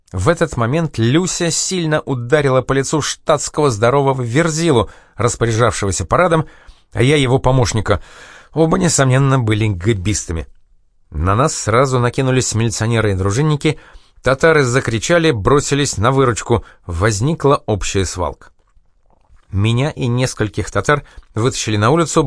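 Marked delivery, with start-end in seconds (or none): none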